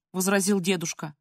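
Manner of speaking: plain reading voice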